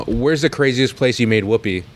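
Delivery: ordinary delivery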